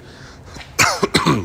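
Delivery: sudden choking cough. Real, not acted. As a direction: cough like you swallowed wrong. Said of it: "I'm choking on my own spit".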